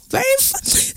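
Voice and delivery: high, soft swoon. Real, not acted. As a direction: sigh it out like a smitten fan. Swooning over the singer.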